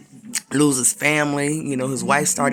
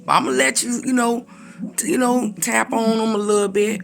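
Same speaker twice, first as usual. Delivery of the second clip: high, louder, brisk voice of God; the Lord answering; 8 semitones higher, 3 dB louder than usual. Retold or imitated. God allowing the enemy to test Job, high and firm. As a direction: grant it but set firm limits. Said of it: "So the Lord said".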